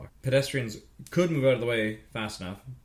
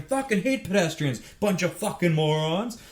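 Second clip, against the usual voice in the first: raised-pitch irritated rant; angry drivers; 6 semitones higher than usual. Retold or imitated. Drivers honking at pedestrians, imitated angry cursing. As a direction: curse at them in a higher, fed-up voice.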